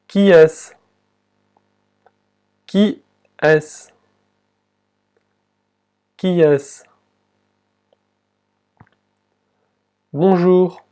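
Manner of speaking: careful French dialogue read for learners; slow model reading. Shown as a demonstration. Teacher reading the French text aloud, carefully. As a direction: read each line clearly for the class. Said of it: "voy a leer dos veces el texto en francés".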